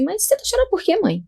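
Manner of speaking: doctor asking the crying mother why, puzzled; doctor's puzzled question. Retold or imitated. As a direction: act out the baffled doctor asking her. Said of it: "E aí ela falou assim".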